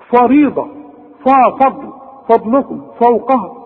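over-thicken the start of فضل and فوقها; wrongly heavy Fa. Shown as a demonstration. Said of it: "كل دي غلط".

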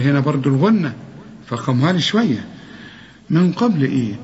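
usual speaking voice

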